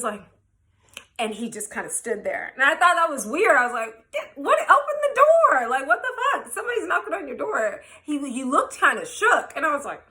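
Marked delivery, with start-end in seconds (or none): 4.1-7.8 high-pitched, laughing disbelief; incredulous urging. Retold or imitated. Guest telling her host to open the door, incredulous. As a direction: push him to act, voice leaping up.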